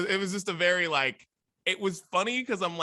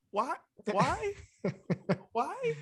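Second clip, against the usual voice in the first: rising disbelief, each why higher; exasperated why; 11 semitones higher, much slower than usual. Real, not acted. Rants at country artists covering songs, exasperated. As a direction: ask why in mounting disbelief.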